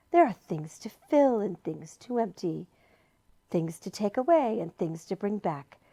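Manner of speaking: soft, hushed luring voice; whispering deceitful lure. A narrator's reading. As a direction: coax them in gently. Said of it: "continued to whisper in a soft, deceitful voice".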